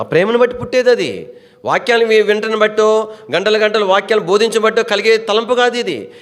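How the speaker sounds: high-pitched, driving sermon delivery; impassioned preaching. Real, not acted. Preacher answering the congregation's excuses, impassioned. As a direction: pile up the phrases in a high, urgent stream.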